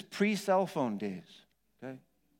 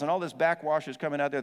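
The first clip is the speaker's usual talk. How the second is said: ordinary talk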